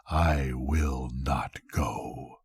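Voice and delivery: slow, low, hushed refusal; whispered threat. Acted. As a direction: breathe it out like a patient ghost. Death's stubborn refusal.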